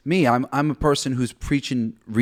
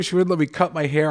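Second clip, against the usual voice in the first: ordinary talk, nothing marked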